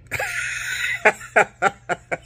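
high-pitched burst of laughter; real laugh. Real, not acted. Flight attendant admitting they weren't really kidding, real high laugh. Laughs at own unintended joke.

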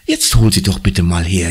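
ordinary delivery

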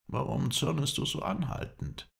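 timidly ask, pitched well up; high, tentative voice with a touch of hesitation; hesitant question. A narrator's reading. Hadidin asks his angry companion after long silence, hesitant. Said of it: "Endlich frug er zagend".

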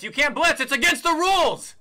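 indignant, incredulous shout; indignant yell. Real, not acted. Repeating complaint, escalating.

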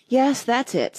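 high, sighed agreement; sighing. A narrator's reading. Hatter confirms Alice's guess, sighing. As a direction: agree with a mild sigh. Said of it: "said the Hatter with a sigh".

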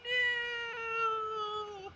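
high, loud, drawn-out mock yell of horror; mock Keanu Reeves shout. Mocking Keanu Reeves pleading in Dracula, high loud slow yell. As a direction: ham up a panicked cry, slowing the last words.

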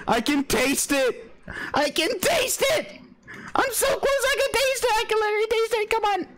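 high, rushed pleading; tense excitement. Real, not acted. Player urging himself on near victory, high-pitched and excited. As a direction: beg the game to let you win. Nearly winning the game.

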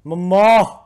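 high, loud call for mother; hammed-up high yell; acted cry. Voicing game character calling mother.

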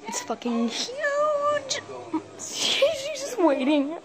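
high, drawn-out tearful wail; real high-pitched freak-out; panicked whine. Real, not acted. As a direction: whine slowly in fright, nearly crying. Frightened by a huge spider overhead.

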